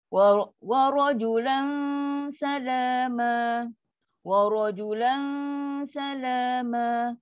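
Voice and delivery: slow "rajulan" held on a hum into "salama"; drawn-out chant, light s in "salama"; dengung before sin. Shown as a demonstration. Teacher demonstrates the hum before sin, held dengung. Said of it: "Kalau macam ni, huruf sin".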